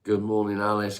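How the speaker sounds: loud bright good morning; cheerful greeting. Real, not acted. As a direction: call out a cheery hello.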